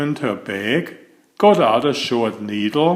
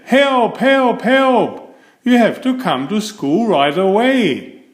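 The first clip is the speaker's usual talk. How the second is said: panicked call; 8 semitones higher than usual. A narrator's reading. Ben calling his mother for help. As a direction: plead urgently down the phone. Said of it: "He said".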